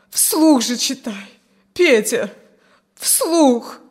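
loud, drawn-out, pleading groan; moaning plea. A narrator's reading. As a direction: beg him, voice rising on the last word. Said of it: "простонала". (со стоном, умоляюще)

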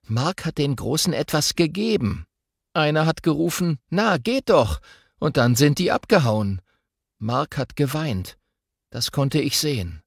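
a hint of a worried boy telling what he saw; lightly voiced boy. Boy telling his twin about the bullying, lightly voiced. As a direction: report it carefully to your sister.

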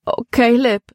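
high-pitched, plaintive lament; wailing. A narrator's reading. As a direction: wail his name in distress. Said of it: "jammerte Libby".

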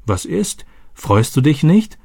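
worried. A narrator's reading. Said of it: "fragte Stani besorgt".

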